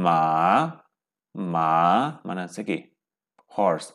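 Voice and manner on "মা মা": মা dipping low then rising, Chinese third tone; Mandarin third tone. Shown as a demonstration. Teacher models the dipping tone of মা for the class. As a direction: drop মা down low, then let it come back up. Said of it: "third tone, মা".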